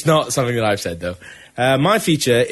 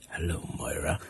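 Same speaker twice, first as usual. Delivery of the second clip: much lower and softer, a mock grave greeting; Patrick Stewart; 9 semitones lower, 15 dB quieter than usual. Retelling the film ending, imitating Patrick Stewart low and soft. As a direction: murmur it low like a resurrected mentor. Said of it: "you just hear him go".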